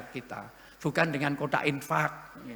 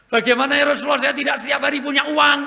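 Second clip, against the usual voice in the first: pleading companion; 9 semitones higher, 10 dB louder, faster than usual. Acted.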